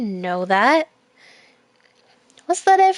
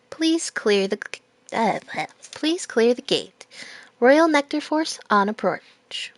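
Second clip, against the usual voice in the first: ordinary delivery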